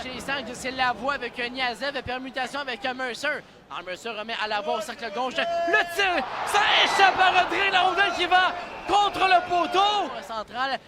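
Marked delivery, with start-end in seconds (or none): 5.4-10.1 rising shout as the puck hits the post; louder, higher burst of excitement; excited play-by-play. Real, not acted. Near miss off the post. (commentaire excité)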